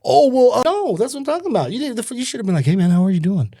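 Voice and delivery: high, quick mock of a flustered reporter. Mimicking his newsman dad's excuses in a high mock voice. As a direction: put on a squeaky, fumbling voice. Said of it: "retells the news guy's reply".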